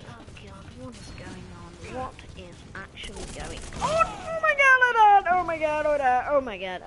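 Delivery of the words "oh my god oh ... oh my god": very high, loud scream sliding downward; panicked wail. Real, not acted. Gamer panicking in the match, high descending wail.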